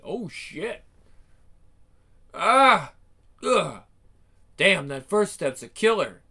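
ordinary delivery

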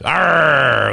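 hearty pirate "arr", loud and high; pirate growl. Pretend. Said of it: "we got pirate stream here".

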